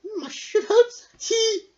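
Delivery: put-on high, loud old woman chuckle; laughing Myrtle. A narrator's reading. Myrtle teasing Merrick, high and amused. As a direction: tease him fondly. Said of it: "Myrtle laughed".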